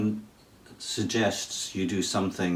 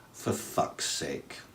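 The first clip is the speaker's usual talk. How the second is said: breathed out low and slow, almost secret; hushed whisper; 5 semitones lower, 7 dB quieter, much slower than usual. Pretend. Teacher whispering the taboo phrase to his students. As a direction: whisper it so nobody hears. Saying the rude one quietly.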